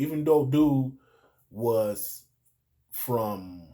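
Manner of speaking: ordinary talk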